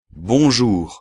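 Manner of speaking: bonjour said the French way. Shown as a demonstration. Teacher models bonjour for the class. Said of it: "la palabra que conocéis todos".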